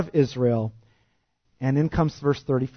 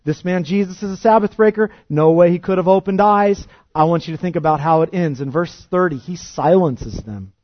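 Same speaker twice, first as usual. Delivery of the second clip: usual speaking voice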